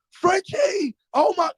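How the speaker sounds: high excited yell of the name; crowd calling out. Retold or imitated. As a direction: call out like fans at the window. Crowd banging on window calling.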